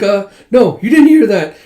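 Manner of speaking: high, loud, flustered denial; mortified backtracking. Retold or imitated. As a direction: take it back in a blushing panic. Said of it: "she just blushes with steam".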